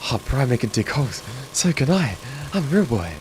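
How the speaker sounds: a lightly voiced delighted fan who just learned he can dig; mock excited viewer. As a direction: play a starry-eyed newbie, Pinocchio-style. Voicing an inspired viewer.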